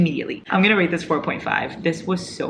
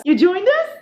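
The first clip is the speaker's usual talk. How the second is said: pet voice; 5 dB louder than usual. Real, not acted. Greeting the cat that joined them in high pet talk. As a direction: coo up at the pet.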